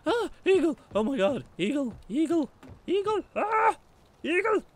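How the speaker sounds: high, loud panicked shrieks; real alarm, shouting eagle; panicked yelling. Real, not acted. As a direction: scream the warning as it swoops. Eagle attacks him in game.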